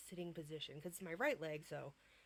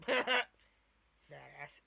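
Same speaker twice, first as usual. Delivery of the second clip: mock teasing boyfriend; 3 semitones lower, 9 dB louder than usual. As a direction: blurt it out teasingly. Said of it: "And he was like".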